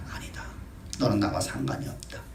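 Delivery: hushed, firm dismissal; Jesus quietly rejecting the proud Pharisee; quiet rebuke. Acted. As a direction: let the verdict fall softly but final.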